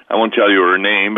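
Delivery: ordinary talk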